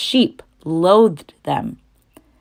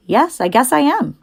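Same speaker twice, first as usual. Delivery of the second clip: higher innocent piglet voice; young pig voice; 8 semitones higher than usual. Acted. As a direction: answer brightly as a young pig. Wilbur the pig answers.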